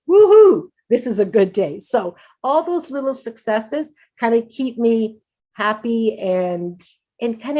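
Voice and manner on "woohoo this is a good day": woohoo cheer. Retold or imitated. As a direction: cheer at a watermelon sale. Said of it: "I'm like".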